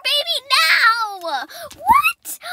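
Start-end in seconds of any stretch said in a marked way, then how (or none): 1.7-2.4 very high, loud "What?"; squealing at the surprise birth; shocked shriek. Acted. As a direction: shriek in disbelief. Foal is being born.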